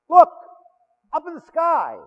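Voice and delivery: high, loud "it's a bird, it's a plane" bit; mock excited spotting. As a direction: point up and call it out. Ascension joke, calling out skyward.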